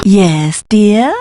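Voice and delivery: ordinary talk, nothing marked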